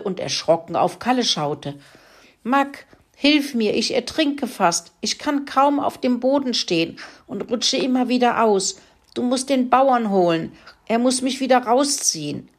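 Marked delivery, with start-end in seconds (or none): 2.4-12.5 higher, squeaky pleading pig voice. Acted. Pig begging the dog to fetch the farmer, squeaky and high. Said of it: "quiekte Kalle".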